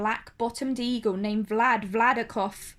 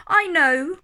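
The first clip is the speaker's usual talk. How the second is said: louder, calling up from far below; called out; 6 semitones higher, 7 dB louder than usual. Acted. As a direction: call it up so he can hear. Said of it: "called the voice".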